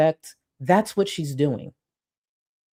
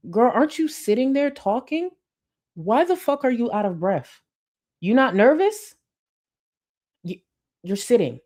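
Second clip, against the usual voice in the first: mock disbelief; 8 semitones higher than usual. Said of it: "I'm like".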